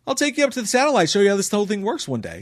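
high, fast pushy eager voice; Cable Guy-esque. Retold or imitated. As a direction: oversell the friendly offer like the Cable Guy. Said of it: "a very Cable Guy-esque moment".

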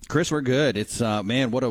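ordinary talk, nothing marked

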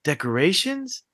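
put-on a soft, hopeful little kid asking; sweet little boy voice. Son asking dad for decorations at dawn, softly sweet. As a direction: coo it like a sweet three-year-old. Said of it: "All nice".

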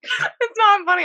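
high, slow, helpless laughter; trying not to laugh. Real, not acted. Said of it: "I'm trying not to laugh".

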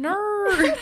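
"No" high and drawn out as "naur"; "No" with a big R on the end; exaggerated Australian R. Shown as a demonstration. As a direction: stretch "No" into a mocking "naur". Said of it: "the amount of R that we put in everything".

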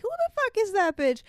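high-pitched jealous imitation; mock indignation. Imitating Joy seeing Craig with Debbie, mock indignant. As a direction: demand it in a high, offended voice.

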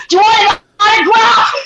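high, shouted mocking offer; over-the-top goading shout; loud taunt. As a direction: yell it out to wind someone up. Retold taunt of an angry man.

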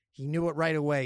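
ordinary delivery

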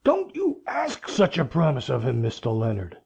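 put-on restrained fury in a low old voice; old man protesting slowly, not shouted; furious protest. A narrator's reading. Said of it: "he cried furiously".

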